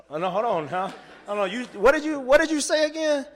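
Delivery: high, loud, can't-believe-it double take; hearing he starts in May, stunned and disbelieving; stunned disbelief. Retold or imitated. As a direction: shoot up high and loud in sheer shock. Stunned at surprise job offer.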